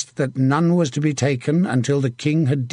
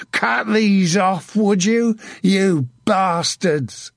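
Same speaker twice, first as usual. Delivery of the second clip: high, drawn-out furious jeer; screamed taunt; 7 semitones higher, much slower than usual. A narrator's reading. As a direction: taunt the dead enemy at a raging pitch. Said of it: "he screamed at the man".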